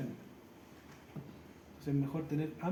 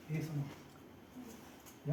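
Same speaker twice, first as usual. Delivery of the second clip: hushed aside; 8 dB quieter, much slower than usual. Real, not acted. Aside during demo.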